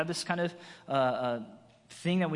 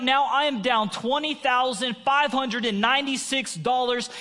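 loud pleading cry to God, higher and louder; desperate prayer; 7 semitones higher, 6 dB louder than usual. Acted. Preacher acting a panicked prayer, loud pleading.

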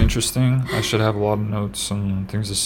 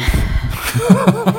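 high laugh; 4 dB louder than usual. Real, not acted. Laughing at own remark.

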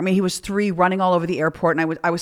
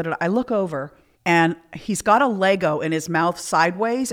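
usual speaking voice

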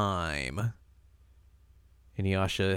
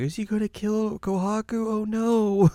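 very high, little squeaky voice; Rin; 12 semitones higher than usual. Retold or imitated. Rin worries about Kohaku, high and squeaky. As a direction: pitch it way up in mock worry. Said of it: "Rin's just like".

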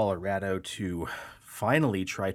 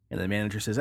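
ordinary talk, nothing marked

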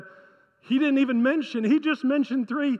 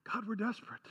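hushed, much softer plea; desperate; 3 semitones lower, 15 dB quieter, faster than usual. Acted. Israel pleads with God after admitting guilt, desperate and hushed. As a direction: drop to a soft, earnest confession. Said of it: "I think the spirit is this".

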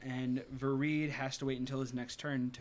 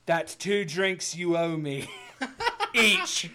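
slow, louder swaggering pirate; a cocky pirate teasing; pirate captain drawl; 4 semitones higher, 6 dB louder, slower than usual. Acted. GM voices pirate Salazar.

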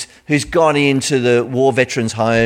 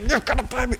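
high, hushed grabbing voice; lightly voiced creditor choking his debtor; angry creditor; 10 semitones higher, 7 dB quieter, faster than usual. Creditor grabs debtor's neck, hushed high demand. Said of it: "and he's like".

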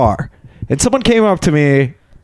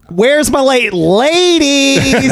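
high, drawn-out call to the ladies; hammed-up sleazy pitchman; 9 semitones higher, much slower than usual. Man hailing strippers backstage, hammed-up drawn-out call. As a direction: stretch it out like a showy club patron. Acts out character greeting strippers.

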